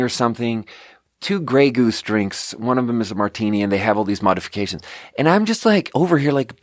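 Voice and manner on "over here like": ordinary delivery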